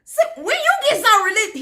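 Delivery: high-pitched incredulous jeer; mocking officers. Other officers mocking him, retold.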